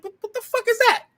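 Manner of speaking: high-pitched incredulous squawk; exaggerated, high clowning reaction; mock disbelief. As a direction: jump way up high, as if appalled by an outfit. Mocking reaction to odd dress, acted.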